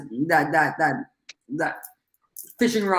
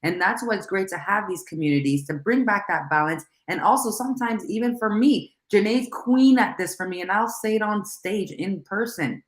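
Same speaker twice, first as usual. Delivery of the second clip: usual speaking voice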